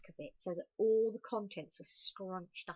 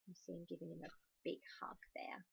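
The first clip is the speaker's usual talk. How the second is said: soft fast aside whisper; 2 semitones lower, 13 dB quieter, faster than usual. Real, not acted. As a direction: lean in and whisper it. Aside to listeners while reading.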